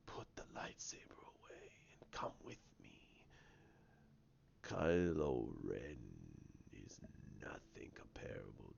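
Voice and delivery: slow, low villain half-whisper; Snoke. Retold or imitated. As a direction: coax softly, drawing out the words. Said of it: "He goes".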